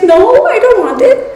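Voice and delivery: hint of a grossed-out friend; refusing friend. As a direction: imitate her recoiling, laughing. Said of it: "And she's like".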